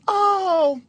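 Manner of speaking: high, drawn-out imitated "oh"; wife's realization. Wife hears he is miserable, high imitated realization. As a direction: pitch it up as the understanding wife. Wife's reaction voiced in story.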